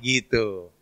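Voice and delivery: ordinary delivery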